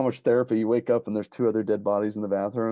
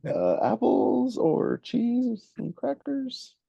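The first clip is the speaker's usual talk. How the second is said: high, slow dithering voice; much slower than usual. Retold or imitated. Killer choosing his snack.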